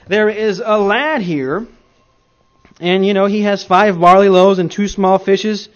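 loud, slow scripture reading; preaching. Acted. Preacher reads verse eight aloud, loud and deliberate. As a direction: proclaim the verse to the congregation.

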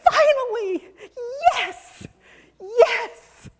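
high, thrilled grandma cry of relief; a burst of joyful relief, pitched high; overjoyed cry. Acted. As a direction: sing out your relief as the kids arrive. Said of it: "this is me saying".

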